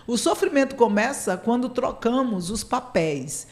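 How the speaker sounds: usual speaking voice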